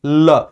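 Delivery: ल said with tongue up and air out the sides; lateral la demo. Shown as a demonstration. Teacher demonstrates lateral ल for the class. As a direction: hold the ल so air slips past the tongue. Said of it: "बोलो जरा ला".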